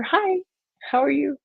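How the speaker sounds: high-pitched hello to a viewer; bright greeting. Real, not acted.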